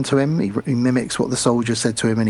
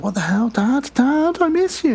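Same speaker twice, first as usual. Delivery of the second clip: put-on much higher girlish voice; 13 semitones higher than usual. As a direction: squeak it like a scared girl. Girl voiced on phone.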